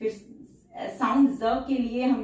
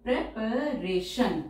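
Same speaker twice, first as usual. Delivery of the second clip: Preparation spelled out slowly; slow teacher dictation; much slower than usual. Shown as a demonstration. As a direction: stretch preparation syllable by syllable. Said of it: "teacher says word then dictates".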